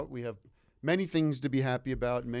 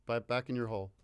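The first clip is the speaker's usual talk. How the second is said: ordinary talk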